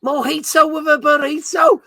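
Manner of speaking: high, hammed-up mock Scouse; attempted Liverpudlian accent. Host reading a viewer's phrase, high mock Scouse accent. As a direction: push a faked Liverpool accent way up in pitch. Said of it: "Sorry to any Liverpudlians out there".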